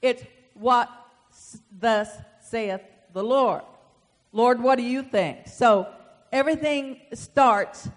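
loud, emphatic pulpit delivery; forceful preaching. Real, not acted. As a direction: preach it hard to the congregation.

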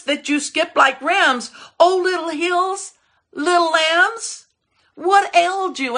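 high taunting questions, climbing in pitch; mocking. David makes fun of the sea and mountains, mocking. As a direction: tease them with exaggerated, drawn-out questions. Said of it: "he makes fun and he mocks".

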